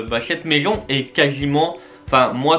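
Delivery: normal talking voice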